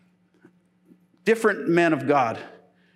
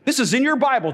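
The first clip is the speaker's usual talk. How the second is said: usual speaking voice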